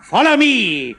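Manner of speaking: loud commanding voice; chemist ordering. A narrator's reading. Chemist ordering the uncles to follow, loud. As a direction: bark out the order.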